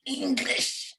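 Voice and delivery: high, slow indignant shout; mock outraged Irish retort. Irish person scolding a nosy asker, mock indignant shout. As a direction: play an offended Irish person snapping back. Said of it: "I mean, like".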